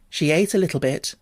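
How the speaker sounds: plain reading voice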